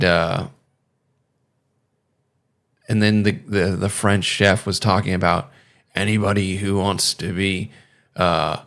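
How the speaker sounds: normal talking voice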